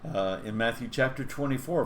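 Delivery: ordinary talk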